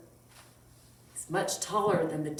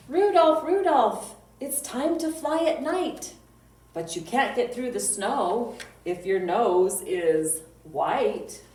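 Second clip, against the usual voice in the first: loud, sing-song poem reading; children's poem recital; 4 semitones higher, 6 dB louder than usual. Acted. Librarian reciting a reindeer poem to kids, loud sing-song. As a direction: chant the rhyme out for little listeners.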